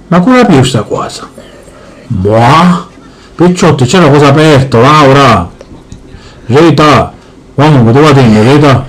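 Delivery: low, heavy father voice; gruff Sicilian dad. Acted. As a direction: boom it out like a grumpy papà. Father again.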